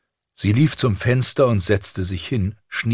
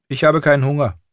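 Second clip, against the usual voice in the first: put-on high, louder child's voice; pitched-up young girl's protest; defiant girl; 9 semitones higher, 5 dB louder, faster than usual. A narrator's reading. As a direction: refuse food like a stubborn little girl. Defiant girl refuses her meal.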